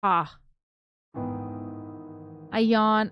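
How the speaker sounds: normal talking voice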